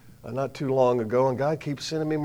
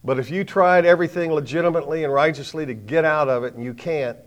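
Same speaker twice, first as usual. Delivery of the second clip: usual speaking voice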